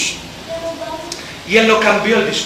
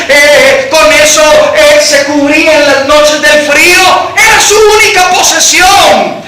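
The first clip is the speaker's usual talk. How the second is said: preaching at full force; 4 semitones higher, 7 dB louder than usual. Acted.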